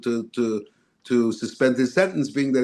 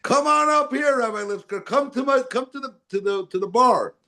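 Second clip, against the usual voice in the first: put-on elderly judge voice; 11 semitones higher than usual. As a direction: call out across the courtroom like an old judge. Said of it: "He says".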